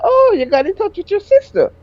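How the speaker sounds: bright high mock-friendly question; forced understanding. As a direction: sound understanding though suspicious. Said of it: "still trying to be understanding".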